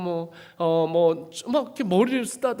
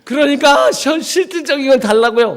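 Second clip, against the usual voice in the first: higher and louder; exasperated demand; 7 semitones higher, 8 dB louder than usual. Retold or imitated. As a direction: insist on something real. Insisting to God.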